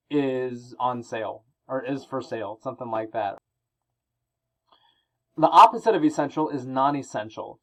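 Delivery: normal talking voice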